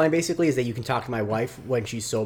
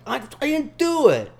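put-on whiny kid; 6 dB louder than usual. Son whining denial.

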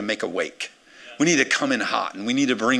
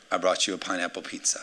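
ordinary talk, nothing marked

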